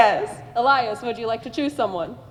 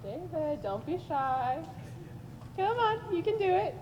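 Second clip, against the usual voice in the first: ordinary talk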